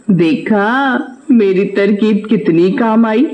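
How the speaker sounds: smug wife with a high cackle; gloating swindler wife. Acted. Swindler's wife gloating to husband about the scam. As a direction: crow over the trick and cackle.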